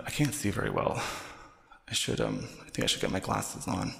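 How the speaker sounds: normal talking voice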